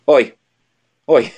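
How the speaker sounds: mock British oi. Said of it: "starts following them and saying".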